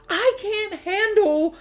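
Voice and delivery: high, loud, slow cry of frustration; exasperated outburst. Retold or imitated. Retelling to viewers how she snapped at her partner, in an exasperated outburst. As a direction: boil over and push the words out. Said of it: "I flew off the handle".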